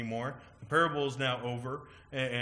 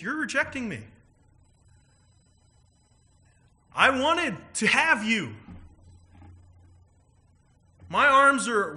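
pleading Jesus; 9 semitones higher, 7 dB louder than usual. Retold or imitated. Jesus pleading with those who reject him, loud and impassioned. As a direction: beg them to hear you, arms wide open.

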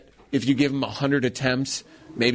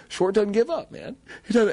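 choking up; 8 semitones higher than usual. Real, not acted. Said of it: "I'm choking up".

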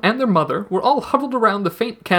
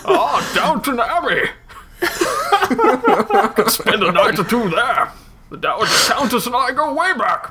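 put-on terrible British accent; 5 semitones higher than usual. Guest showing off a terrible British accent, laughing. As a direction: ham up a posh British aristocrat badly. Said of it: "That was terrible".

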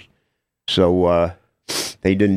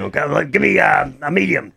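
Seymour Lustig voice; 6 semitones higher than usual. Retold or imitated. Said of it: "I don't know when to stop now".

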